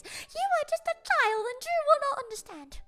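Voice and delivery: high, laughing mock lecture; mock adults scolding. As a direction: parody the grown-ups talking down. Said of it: "no one will give me the full picture".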